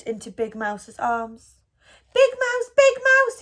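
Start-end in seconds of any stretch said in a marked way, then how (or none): 2.1-3.4 high, louder calling out in relief; crying out. A narrator's reading. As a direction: call out desperately to Big Mouse. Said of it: "he cried".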